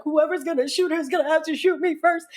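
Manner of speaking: high, breakneck-brave retold vow; protective big sister. Big sister shielding her sister from shooting, high mock-heroic.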